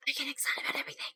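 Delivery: soft, voiceless whisper. Shown as a demonstration. Streamer confesses excitability to viewers, whispering.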